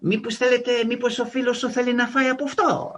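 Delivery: high, quick, eager-host impression; Greek restaurant owner. Owner offering free food to his new nephew, high mock eagerness. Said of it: "He's tr-, saying".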